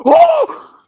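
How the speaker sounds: huge high shout of joy; goal scream. Real, not acted. Friends watching the match, a goal scream.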